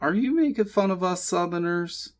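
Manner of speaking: high-pitched mock Southern belle; Scarlett O'Hara. A narrator's reading. She mock-accuses him of mocking Southerners, Southern belle act. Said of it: "doing her best Scarlett O'Hara imitation".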